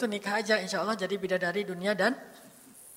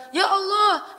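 higher, louder, forceful cry; firm war prayer; 10 semitones higher, 5 dB louder, much slower than usual. Shown as a demonstration. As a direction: call out to God with firm resolve. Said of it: "doa khusus untuk perang". (seruan doa yang tegas dan lantang)

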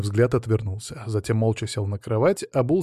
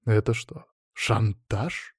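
mild, understated disbelief; a touch taken aback; surprised; 4 dB quieter, much slower than usual. A narrator's reading. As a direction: question it with quiet surprise. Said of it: "удивился я".

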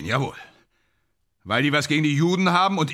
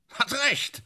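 high, strained insistence; agitated outburst; 12 semitones higher than usual. Acted. Drunken actor agreeing with his old teacher, agitated outburst. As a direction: cry it out, worked up. Ja, beruhige dich.

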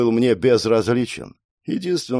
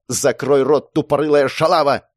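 furious, higher-pitched insult; angry yell; 4 semitones higher, 3 dB louder than usual. Acted. Mei turns on the girlfriend, yelling angrily. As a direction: lash out at her. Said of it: "заорала Мэй".

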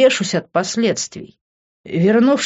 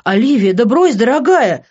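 louder, higher call; shouting after her; 6 semitones higher, 6 dB louder than usual. A narrator's reading. Vincent shouts after Liv as she walks off. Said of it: "крикнул ей вслед Винсент".

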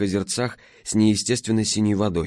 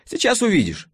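весело, с загадкой; 12 semitones higher than usual. A narrator's reading. Алёна смеётся, машет рукой.

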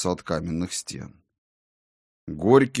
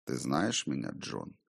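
ghostly double's voice; 6 semitones lower, 10 dB quieter than usual. A narrator's reading. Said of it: "очень похожий на мой".

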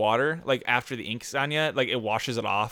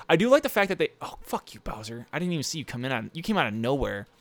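ordinary talk, nothing marked

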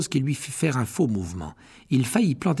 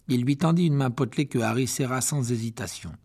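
ordinary delivery

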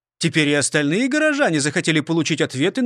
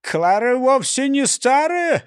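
high, slow protest; hurt objection; 7 semitones higher than usual. A narrator's reading. Mayor defends his parrot Клара, high-voiced objection. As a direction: object slowly, pitched up. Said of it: "возразил бургомистр".

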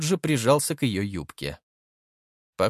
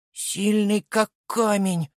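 put-on smiling little boy; 9 semitones higher, slower than usual. A narrator's reading. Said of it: "улыбаясь, сказал мальчик".

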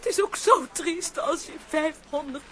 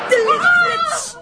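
alarmed cry; 9 semitones higher, 8 dB louder than usual. Acted. Sees the fairy's flash.

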